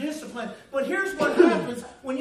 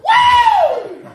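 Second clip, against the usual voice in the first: very high, very loud woo of pain; howled yelp of pain; 16 dB louder than usual. Retold or imitated. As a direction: howl like the paddle just landed. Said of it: "they heard me howl".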